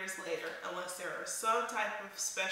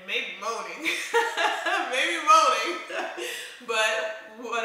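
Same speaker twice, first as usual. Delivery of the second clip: laughing out the words, higher and much louder; 3 semitones higher, 8 dB louder, slower than usual. Real, not acted. Vlogger laughing at her own joke about wives, laughing. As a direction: crack up on the repeat.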